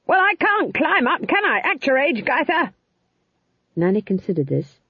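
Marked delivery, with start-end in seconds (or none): 0.1-2.7 high-pitched, indignant scream back; very high, exasperated yell. A narrator's reading. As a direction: scream the retort into the other's face. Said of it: "They stared into one another's faces and screamed".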